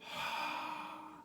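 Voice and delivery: long soft unvoiced sigh; deep sigh. Shown as a demonstration. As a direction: let out a deep breath of a sigh. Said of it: "almost like a deep sigh".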